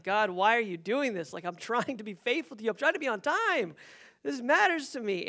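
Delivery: exasperated plea to God, rising in pitch; mad. Retold or imitated. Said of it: "I'm mad".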